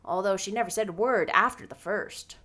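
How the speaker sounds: brisk, swooping scornful delivery; disapproving older character. Acted. Grumbling about ridiculous puffed sleeves, scornful. Marilla's dialogue in the novel.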